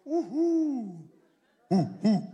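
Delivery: imitating an owl hooting. Preacher imitates the owl that scared him as a child, hooting. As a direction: hoot like an owl in the dark. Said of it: "the owl go".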